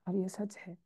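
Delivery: ordinary talk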